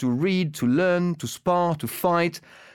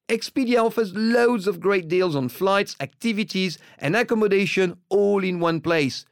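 ad read; 3 semitones higher than usual. Acted. Host reads the Expedia sponsor spot to listeners, upbeat. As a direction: sell it brightly to listeners.

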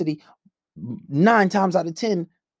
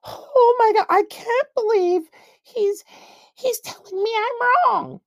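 put-on much higher, slow, whiny girly voice; mock shocked woman; slower than usual. Mocking a woman stunned that a man tells her she's wrong, high and drawn out. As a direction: ham up a shocked woman's gasp of disbelief. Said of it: "They're like".

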